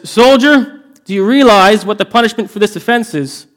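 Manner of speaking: a high, loud royal demand; stern king. Acted. King confronting the soldier caught asleep, stern and loud. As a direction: loom over the guard and demand it.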